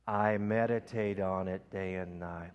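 slow, prayerful, drawn out; slow breath prayer. Shown as a demonstration. Preacher praying aloud with the congregation, slow. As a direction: let it out slowly on the breath. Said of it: "Do it with me".

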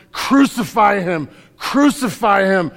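loud, slow chant of the mob; crowd shouting. Retold or imitated. Preacher voicing the mob demanding crucifixion, loud shout. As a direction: yell it like the angry crowd.

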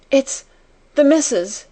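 choked, frightened high voice. A narrator's reading. Maid to her friend, choked with fear. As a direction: gasp it out in terror. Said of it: "choked Becky".

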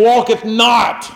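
high, slow, drawn-out scripture call; call-and-response recitation. Acted. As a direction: lead the congregation in repeating it. Leads congregation in response.

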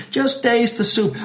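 high, insistent order; exasperated diner. Acted. Diner cutting off waiter's questions, high exasperated insistence. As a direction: insist with worn-out patience.

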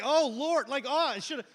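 loud, high exasperated cry; mock anguished lament. Student seeing puddle on his seat, mock anguish. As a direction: cry out in hammed-up dismay.

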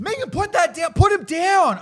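high, loud frantic pleading; hammed-up urgent squeal; mock panic. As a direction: imitate panicked kids giving orders. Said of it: "they're all like".

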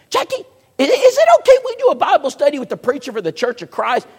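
squeaky high pleading wife asking; high woman's voice. Retold or imitated. Sheila asks her husband permission, high and eager. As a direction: lift into a high, eager little voice.

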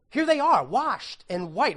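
joyful slow proclamation; exultant. Real, not acted. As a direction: proclaim it with joy. Exulting over the vision.

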